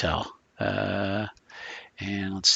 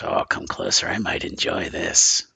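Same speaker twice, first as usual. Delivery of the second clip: low, creaky, savoring invitation; lip-licking menace; 14 semitones lower than usual. Acted. Wounded captive invites her tormentor closer, low savoring menace. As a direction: relish it like a hungry predator. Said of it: "licks her lips and she goes".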